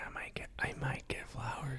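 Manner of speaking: hushed mumbled whisper. Pretend. Whispering back about buying flowers. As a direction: keep it barely audible.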